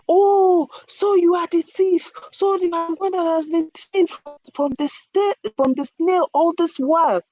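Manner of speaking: high-pitched jeering at the culprit; insulting villagers. A narrator's reading. Villagers insulting the trapped tortoise, high-pitched scornful scolding. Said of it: "insulting him".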